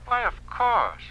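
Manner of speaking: high, loud false cheer; mock generous. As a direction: sound all too willing. Official feigns easy agreement.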